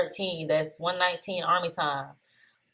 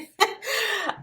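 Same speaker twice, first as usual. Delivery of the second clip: high, helpless giggle; real laugh. Real, not acted. Trader amused at fumbling a word, laughing. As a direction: giggle at your own slip. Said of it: "so that's why I'm laughing".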